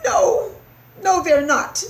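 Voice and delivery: high, loud mouse squeak; urgent little mouse; interrupting. A narrator's reading. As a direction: cut in with a squeaky denial. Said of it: "interrupted Christopher".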